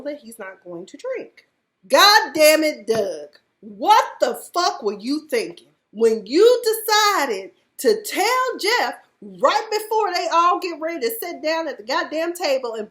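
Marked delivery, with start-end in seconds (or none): 1.9-3.4 loud, slow exasperated curse, pitched higher; frustrated yell at Doug; exasperated outburst. Real, not acted. 3.6-11.1 loud higher-pitched rant; furious rant at Doug; angry rant. Real, not acted. Ranting at Doug.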